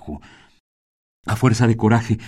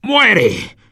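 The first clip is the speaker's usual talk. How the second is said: killing cry; 12 semitones higher, 5 dB louder than usual. Acted. Attacker stabbing José.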